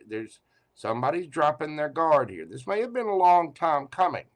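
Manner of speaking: ordinary talk, nothing marked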